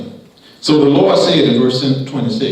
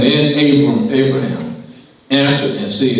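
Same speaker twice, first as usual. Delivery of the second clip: ordinary delivery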